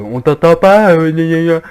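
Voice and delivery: high, loud mocking whine; mocking whiny friends. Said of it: "ils me disent".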